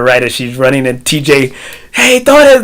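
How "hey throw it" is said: high, loud mock cheer; imitated cheering yell. Friend cheering on his daughter at the race, imitated yell. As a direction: holler it like a fan at the rail.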